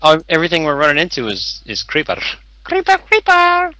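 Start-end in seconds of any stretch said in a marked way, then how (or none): 2.6-3.7 high, slow squeal; drawn-out high-pitched mock alarm; alarmed cartoon cry. Calling out at creepers everywhere.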